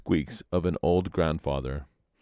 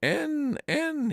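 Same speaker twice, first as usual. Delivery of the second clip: high strained grunting while kicking in water; high grunts. A narrator's reading. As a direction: yelp it out, struggling. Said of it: "he grunted".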